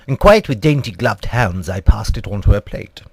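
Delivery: plain reading voice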